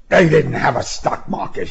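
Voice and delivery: mild shout. A narrator's reading. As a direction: raise the pitch in protest, no full shout. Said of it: "he shouted".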